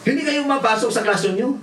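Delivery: high, rising reproach; scolding. Retold or imitated. Pastor scolding truant students, high and sharp. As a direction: press them with pointed questions.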